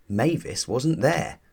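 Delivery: usual speaking voice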